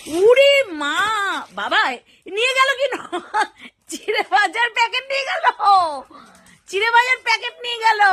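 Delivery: high amazed laughter; laughing surprise. Real, not acted. Owner laughing as the pet grabs the snack packet. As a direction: laugh in delight at the theft.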